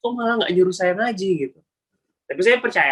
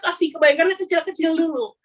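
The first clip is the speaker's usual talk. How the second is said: ordinary talk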